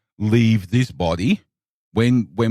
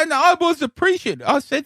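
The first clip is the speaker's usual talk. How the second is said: ordinary talk, nothing marked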